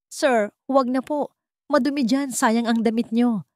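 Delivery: put-on a young woman's voice protesting politely; polite refusal. A narrator's reading. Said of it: "Tanggi ni Liwayway".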